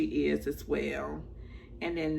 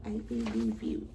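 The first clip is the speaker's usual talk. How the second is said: low quiet; 8 semitones lower, 5 dB quieter than usual. Shown as a demonstration. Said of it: "I believe you (repeated)".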